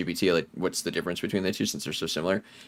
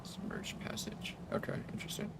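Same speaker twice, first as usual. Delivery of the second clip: whispering; 14 dB quieter than usual. Real, not acted. Quiet exploring in game.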